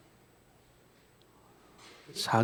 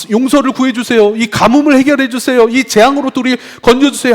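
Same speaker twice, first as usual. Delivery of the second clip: high, loud desperate plea; urgent pleading; 9 semitones higher, 10 dB louder than usual. Acted. Israelites begging God to end the drought, urgently pleading. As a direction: beg God urgently for rescue.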